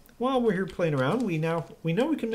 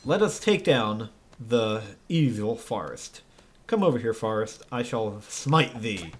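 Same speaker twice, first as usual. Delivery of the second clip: mock biblical prophet; 6 semitones lower than usual. Playing angelic avenger, archaic speech.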